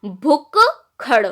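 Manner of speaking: भुक्खड़ said very slowly, as bhuk-khaṛ with the k doubled; भुक्खड़ stretched out, with the k then kh clearly split; careful demo. Shown as a demonstration. Teacher slowly spelling out भुक्खड़ aloud. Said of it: "का बनेगा पहले".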